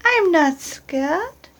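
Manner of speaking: put-on high eager child creature; Gruffalo's child. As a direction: squeak it out like a curious young monster. Child boasts again.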